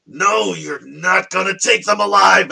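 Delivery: full-volume, high drawn-out shout; dramatic drunken hero's battle cry; defiant yell. Acted. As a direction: yell it out, stretching the words. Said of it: "and I just yell out".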